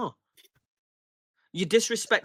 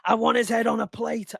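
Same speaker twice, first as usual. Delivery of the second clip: raised-pitch, louder mock fury; retold rage; 7 semitones higher, 4 dB louder than usual. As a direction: spit out the threat a bit louder. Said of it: "ape shit".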